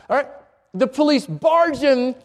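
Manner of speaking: normal talking voice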